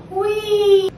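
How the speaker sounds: high, playful yelp; excited squeal. Real, not acted. Excited exclamation.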